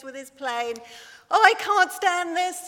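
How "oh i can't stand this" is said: higher, louder wail of exasperation; exasperated Daddy Bear. Acted. Daddy Bear kept awake by Baby Bear, a higher, louder exasperated cry. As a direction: throw up your hands at the aeroplane noise. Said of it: "says Daddy Bear".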